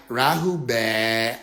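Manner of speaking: very low, very slow drawn-out Rahu; mock greeting. Newcomer greeting everyone, mocked deep and slow. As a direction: drag the words out gravely. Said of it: "came in and was like".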